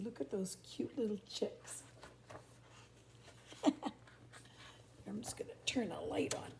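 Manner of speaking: hushed unvoiced whisper over the book; whispered aside. Real, not acted. Whispering an aside to the listening children. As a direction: whisper so as not to wake anyone.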